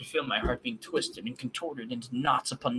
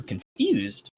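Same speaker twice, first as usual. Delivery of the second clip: usual speaking voice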